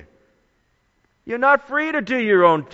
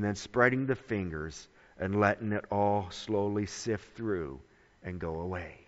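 low, soft, trailing away; hushed, fading; 8 semitones lower, 10 dB quieter than usual. Real, not acted. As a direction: let it sift away softly, sinking in pitch and volume. Image of sand slipping away.

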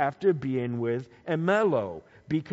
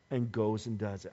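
normal talking voice

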